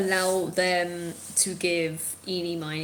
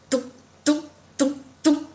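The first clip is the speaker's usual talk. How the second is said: mock thumping; 6 semitones higher, 8 dB louder than usual. GM mimics spectral head thumping.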